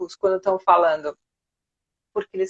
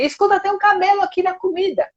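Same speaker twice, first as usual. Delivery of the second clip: high-pitched, outspoken complaint; mock blunt complaint; 9 semitones higher than usual. Diner bluntly announcing a camel in the food, exaggerated. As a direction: come straight out with it, pitched high. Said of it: "A pessoa não fala assim".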